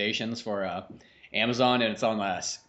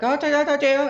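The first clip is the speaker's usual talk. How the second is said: imitating lady; 13 semitones higher, 7 dB louder than usual. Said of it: "talking in Chinese".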